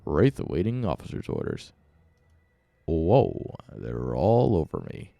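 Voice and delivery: low game unit voice, dragged out; Wraith. Pretend. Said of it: "I love the Wraith voice".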